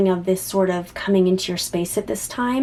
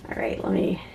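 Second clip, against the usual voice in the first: whisper; 8 dB quieter than usual. Real, not acted. Shuffling cards, talking to herself.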